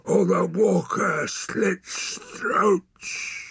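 slow, high, loud bellow; bellowed threat. A narrator's reading. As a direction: roar the warning, dragging out each word. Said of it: "bellowed a voice behind him".

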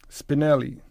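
loud, high exclamation of a name; Tata's exclamation. A narrator's reading. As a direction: exclaim it loudly over the noise. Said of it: "Tata exclaimed against the noise".

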